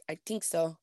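soft, lightly attempted Irish accent, TH turned to T; imitated Irish accent. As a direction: toss off a quiet mock-Irish example. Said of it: "that's how they say".

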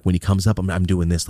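normal talking voice

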